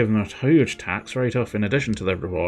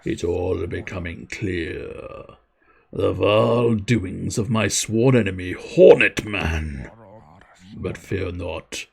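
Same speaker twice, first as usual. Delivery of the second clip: menacing beekeeper plotting revenge, slow and low; low, slow mad beekeeper; 3 semitones lower, slower than usual. Acted.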